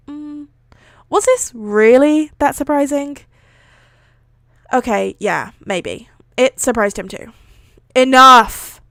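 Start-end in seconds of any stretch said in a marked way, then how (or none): 1.1-3.2 higher, louder push on "this really"; incredulous question. A narrator's reading. Teacher puzzled by his class's reaction, incredulous stress on "really". Second take, more incredulous. 8.0-8.8 loud, firm command at a usual pitch; shout. A narrator's reading. Fed-up teacher silencing his noisy class, shouted. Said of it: "he finally shouted".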